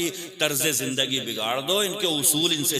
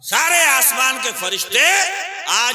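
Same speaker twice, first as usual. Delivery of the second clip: loud, high proclamation; high shout with a rising end; full-force preaching; 9 semitones higher, 9 dB louder than usual. Real, not acted. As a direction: announce it to the crowd with a raised voice.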